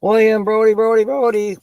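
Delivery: high, loud chanted name; greeting a viewer by calling his name out; sing-song name call. Real, not acted.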